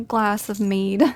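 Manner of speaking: normal talking voice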